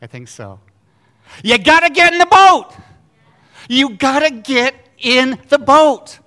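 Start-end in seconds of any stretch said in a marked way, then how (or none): 1.4-6.2 loud, high preacher's shout; thundering imperative. Real, not acted. Preacher commanding the congregation into the boat, thundering imperative. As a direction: hammer the command out at full force.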